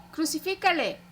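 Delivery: the mob's chant growing in force; building crowd cry. A narrator's reading. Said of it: "continuaban gritando".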